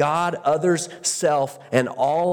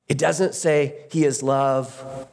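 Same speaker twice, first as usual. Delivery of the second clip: ordinary delivery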